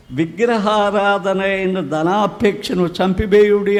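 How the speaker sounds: slow, solemn verse with చంపివేయుడి stressed; intoned Bible verse; scripture reading. Retold or imitated. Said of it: "అంటాడు".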